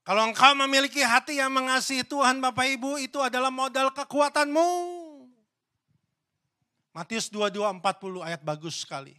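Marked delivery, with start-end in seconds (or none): 0.0-4.7 preaching loudly. Acted. Preaching climax to congregation.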